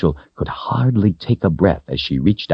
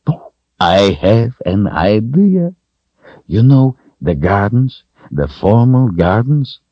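grinning wizard; 5 semitones higher, 4 dB louder than usual. A narrator's reading. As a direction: announce an idea with a lilting, rising grin. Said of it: "his big grin came back".

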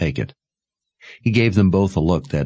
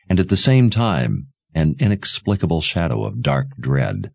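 plain reading voice

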